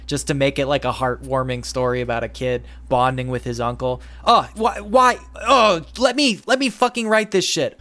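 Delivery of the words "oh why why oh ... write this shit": exasperated outburst. Real, not acted. Exasperated outburst at anime tropes.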